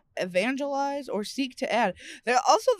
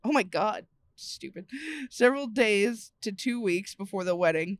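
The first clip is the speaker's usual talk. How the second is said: ordinary delivery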